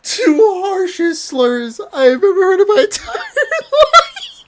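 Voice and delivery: high, breaking into laughter by the end; can't hold it together, laugh through the words. Real, not acted. Host cracking up repeating what his friend said.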